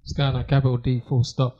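low put-on deep voice. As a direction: drop the voice way down. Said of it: "Why is everybody just gone down".

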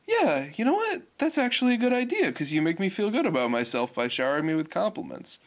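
imitated high-pitched, eager yes; Korra agreeing. Korra accepting Bolin's date idea, mock high-pitched agreement. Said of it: "And she's like".

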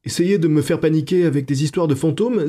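high, rattled protest; upset reproach, pitched high; indignant. A narrator's reading. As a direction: push back, frightened and offended. Clara upset at ghost talk.

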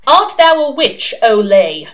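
loud, grand mock interrogation; inquisitor's challenge. A narrator's reading. Chief inquisitor questioning a suspected witch, loud and commanding. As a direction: call it out like a pompous inquisitor.

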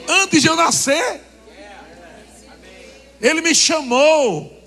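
drawn-out emphatic cry; emphatic proclamation. Real, not acted. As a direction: proclaim it from the pulpit. Preacher stressing calling.

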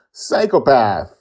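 loud, high announcing shout; wrong tone. Real, not acted. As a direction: call it out too high and loud. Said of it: "That was the wrong tone for that".

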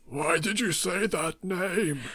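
high, drawn-out Batman cry; hammed-up anguished Batman; Batman impression. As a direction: stretch every word out in a high, dramatic Batman voice. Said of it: "and Batman's".

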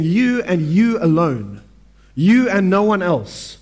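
slow, heavy stress on each "you"; "you" and "no one else" both stressed and drawn out; emphatic "you". Shown as a demonstration. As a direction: press "you" down hard and slow. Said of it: "it's written emphatically".

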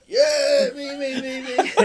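high-pitched mock clamour of kids; laughing, hammed-up eagerness; eager volunteers. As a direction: imitate schoolkids begging to be picked. Pupils all volunteering eagerly.

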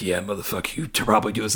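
a hint of a hammed-up villain; mock intimidating villain. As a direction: sneer it like a tough guy trying too hard. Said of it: "super intimidating or evil".

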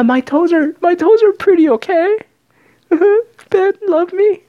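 high-pitched mock rant; upset contestant. Upset contestant whining to Ben about her toes, high mock indignation.

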